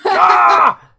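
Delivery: loud, high "Ah!" as laughter erupts; explosive shouted guffaw in character; burst of laughter. Acted. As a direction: bark out a big delighted whoop. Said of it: "He immediately bursts into laughter".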